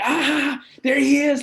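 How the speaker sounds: high, loud happy cry of greeting; excited students. Retold or imitated. Students spotting their teacher, excited high shout. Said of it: "students ... be like".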